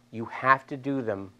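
ordinary delivery

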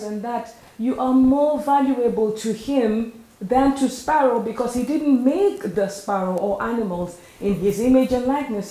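a hint of full-force preaching. Preacher builds to emphasis.

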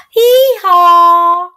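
high, loud hee-haw; donkey bray. Acted. Donkey sound for children.

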